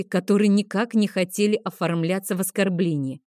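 usual speaking voice

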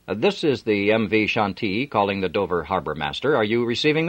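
captain calling the harbour master; captain on the radio. A narrator's reading. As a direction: speak clearly into the microphone.

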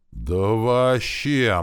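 high, loud, very drawn-out exclamation; emphatic awe. A narrator's reading. Orc recalling the punch that hit him, emphatic awe. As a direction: stretch it out to stress how bad it was.

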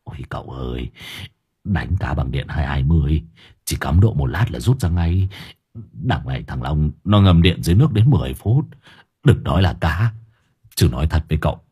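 low-pitched voice of a worried crook; Hải Nghẹo, alarmed reproach. A narrator's reading. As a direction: explain grimly in a low voice.

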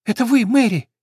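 high, loud anxious question; frightened sparrow. Acted. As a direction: ask into the dark, afraid.

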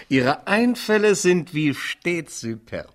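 amused. Acted. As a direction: laugh it out with mock admiration. Lachende ironische Antwort. (lachend, höher und langsam)

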